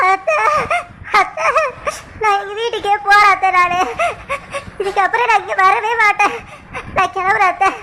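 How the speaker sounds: acted tearful sobbing between phrases; crying. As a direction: sob as you announce you are leaving. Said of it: "ஏன் அப்படிம்மா அழுவுற நீ?".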